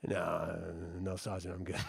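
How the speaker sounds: low, soft brush-off; muted, low-pitched 'no thanks' to a superior; retold polite refusal. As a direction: quietly decline the handshake.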